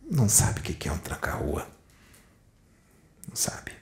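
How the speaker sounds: breathy hushed whisper, almost no voice; whispered aside. Real, not acted. Whispering to viewers about the tranca-rua. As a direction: drop to a secret whisper.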